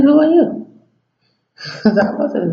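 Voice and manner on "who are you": much higher, louder startled "who are you"; shocked question. Retold or imitated. Caller hearing a woman answer, high and startled. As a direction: caught off guard by a strange woman. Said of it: "And I'm like".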